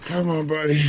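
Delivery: grunting with strain; effort grunt. Real, not acted. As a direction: force it out mid-climb. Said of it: "sorry for all the grunting noises".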